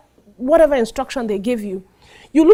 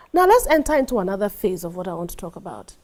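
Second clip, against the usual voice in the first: ordinary delivery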